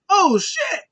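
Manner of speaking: loud, very high exclamation; mock shocked outburst. Bray Wyatt reacting to the bloody tongue, mock shocked cry.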